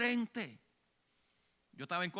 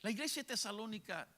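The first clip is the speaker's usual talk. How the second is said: normal talking voice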